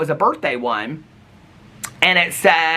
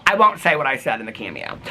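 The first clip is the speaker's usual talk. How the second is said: ordinary delivery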